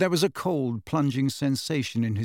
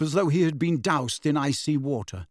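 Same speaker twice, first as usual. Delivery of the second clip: usual speaking voice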